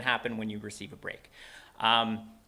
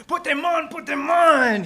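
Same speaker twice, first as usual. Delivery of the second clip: put-on very high, loud, insistent old man; eager, loud, squeaky-high pleading; eager old shoemaker; 10 dB louder than usual. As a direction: urge it excitedly in a high character voice. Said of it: "he said eagerly".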